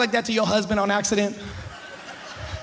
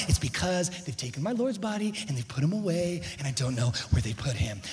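soft, lowered, composed answer; quietly keeping composure, sinking lower; holding it together; 6 semitones lower, 9 dB quieter than usual. Acted. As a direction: keep the voice down and contained. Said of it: "you kept it together".

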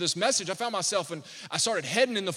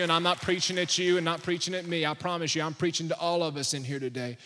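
ordinary talk, nothing marked

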